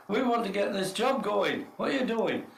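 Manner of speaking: high, indignant nagging; imitated impatient demand. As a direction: act out a workmate chivvying. Ripper demands he get on.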